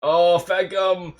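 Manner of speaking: usual speaking voice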